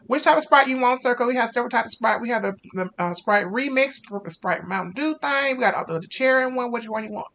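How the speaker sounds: high, loud, bright-voiced fast-food worker; over-eager drive-thru cashier greeting the car; chirpy drive-thru cashier. Cashier [name] takes drink order.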